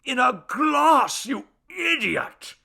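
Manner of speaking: high, very loud, stretched-out scolding; shrieking exasperated little god; enraged. A narrator's reading. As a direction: shout it slowly in a fit of rage. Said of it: "The god hopped with rage".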